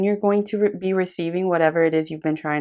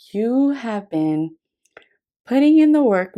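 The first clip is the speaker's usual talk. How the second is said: you have been, slow, then work much higher and louder; slow emphatic stress; 6 semitones higher, 5 dB louder than usual. Real, not acted. Reader insisting to the viewer, building to a high loud stress. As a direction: lean hard into putting in the work. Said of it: "emphasis to reassure".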